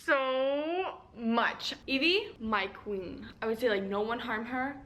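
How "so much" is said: drawn-out gush. Real, not acted. Gushing about the ending.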